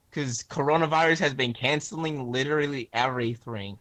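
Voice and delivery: ordinary talk, nothing marked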